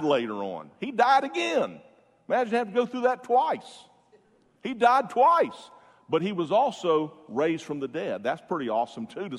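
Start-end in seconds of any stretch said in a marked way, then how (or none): 0.8-5.7 excited wonder. Real, not acted. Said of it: "marvelling at Lazarus".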